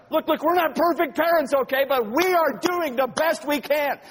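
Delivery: high, loud scolding, slowing at the end; exasperated parent. Retold or imitated. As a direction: plead and scold the ungrateful kids.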